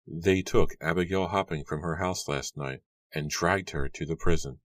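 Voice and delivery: ordinary delivery, nothing marked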